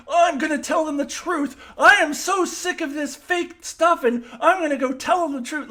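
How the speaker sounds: high, loud mocking impression of Mariner. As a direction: sneer it out as a petulant, vindictive Mariner. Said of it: "'cause she's like".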